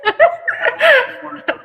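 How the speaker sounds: real high-pitched cackle. Real, not acted. Reader cracks up at the story, high cackling laugh.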